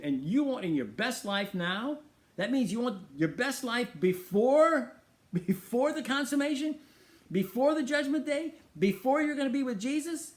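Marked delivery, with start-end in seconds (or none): 2.4-10.3 higher and higher with a laugh, mounting disbelief; incredulous laughing build. Real, not acted. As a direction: pile up the befores, laughing at the idea.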